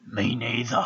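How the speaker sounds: imitating the stranger. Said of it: "I'll try and get his voice right".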